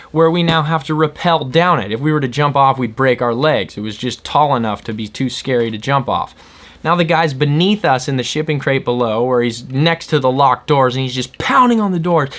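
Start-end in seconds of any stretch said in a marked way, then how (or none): none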